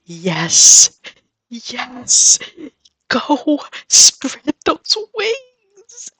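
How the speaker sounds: climbing, high, tearful yells; ecstatic cheering. Real, not acted. Gamer sending off an unwanted villager, ecstatic cheering. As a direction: cheer her off like a bird leaving the nest.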